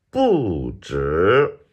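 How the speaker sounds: "不" falling from high, "止" rising; fourth then second tone. Shown as a demonstration. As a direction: drop "不" long, then swoop "止" upward. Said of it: "same tones".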